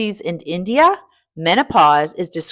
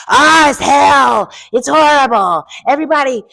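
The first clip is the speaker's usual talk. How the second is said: much louder mock lament; mock doom lament; 7 semitones higher, 9 dB louder than usual. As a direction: wail like it's the end of the world. Mocking menopause as hell.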